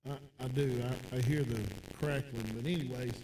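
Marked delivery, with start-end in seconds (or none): none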